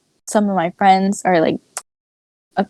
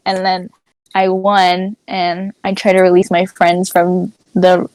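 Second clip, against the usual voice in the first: ordinary delivery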